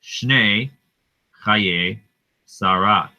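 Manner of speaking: slow word-by-word reading of "Shnei Chaye Sarah"; Hebrew reading. Shown as a demonstration. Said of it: "what does it say".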